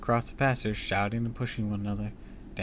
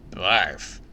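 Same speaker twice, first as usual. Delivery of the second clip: loud queasy groan; groaned disgust; 3 semitones lower, 6 dB louder than usual. A narrator's reading. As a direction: groan it out as if sickened. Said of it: "Howard groaned".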